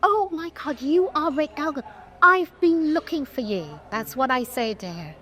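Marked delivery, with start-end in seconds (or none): 0.0-3.8 re-enacted starstruck gasp, pitched high; almost shaking. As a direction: act overwhelmed at finally finding them. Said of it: "And I'm almost shaking".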